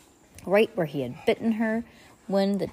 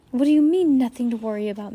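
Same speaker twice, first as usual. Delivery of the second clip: higher, louder disbelieving question; incredulous; 6 semitones higher, 6 dB louder, faster than usual. A narrator's reading. Mister Poe doubting Stefano, incredulous. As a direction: demand it in disbelief. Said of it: "asked incredulously".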